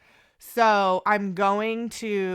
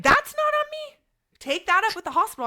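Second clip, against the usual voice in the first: high, dismissive retort, rushed at the end; curt brush-off; 13 semitones higher, 6 dB louder than usual. Retold or imitated. Doctor brushing off her patient, high and dismissive. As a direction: snap back like a cold nurse.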